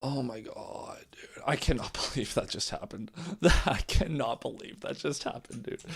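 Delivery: soft, breathy, laughing in shock; can't believe it, laughing quietly; laughing disbelief. Real, not acted. Fan stunned by a blunder, soft laughing disbelief. Disbelief after bad play.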